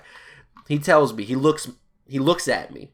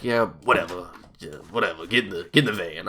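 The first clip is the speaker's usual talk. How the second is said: a hint of a low, dismissive grumble; lightly voiced annoyed coworker, brushing him off; grumpy brush-off; 5 semitones lower, faster than usual. Randy brushing off the new guy, low grumble.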